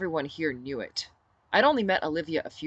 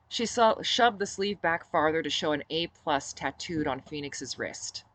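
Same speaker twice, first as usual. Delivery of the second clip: usual speaking voice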